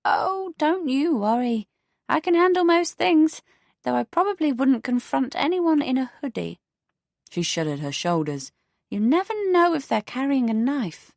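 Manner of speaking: put-on a prim elderly neighbour; prim older neighbour. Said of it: "Her face had a glow".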